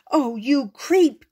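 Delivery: angry cry. Acted. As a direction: yell at him for ruining it. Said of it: "cried Susie".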